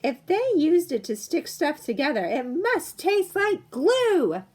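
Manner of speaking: put-on a bratty girl sneering at the food; disgusted little girl. Angelica complains the haroset tastes like glue, bratty.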